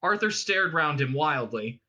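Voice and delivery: ordinary delivery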